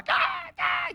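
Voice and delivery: very high screeching caw; parrot screech. Retold or imitated. Preacher imitating the parrot screaming after the bite, high screech. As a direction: shriek it like a parrot. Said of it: "tipo assim".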